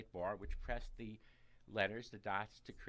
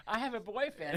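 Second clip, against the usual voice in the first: much higher, loud mock scream; imitated childish scream; 12 semitones higher, 9 dB louder than usual. Boy answering his blind neighbor, imitated excited scream. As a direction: blurt it out like an overexcited boy. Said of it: "I screamed".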